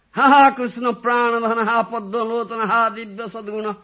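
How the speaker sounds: high, loud wailing call to Krishna; crying lament. Acted. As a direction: cry out his names in longing. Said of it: "Then started crying".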